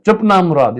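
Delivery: high, sharp hush. Acted. Wife silencing her crying son, sharp. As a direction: snap at the crying child.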